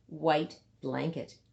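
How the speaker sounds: plain reading voice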